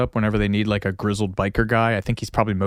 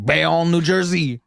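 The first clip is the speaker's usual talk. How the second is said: drawn-out, higher mock Cajun accent; 5 semitones higher, slower than usual. Shown as a demonstration. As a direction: stretch it out in a playful Cajun accent. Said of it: "pronouncing Bayonne with a Cajun accent".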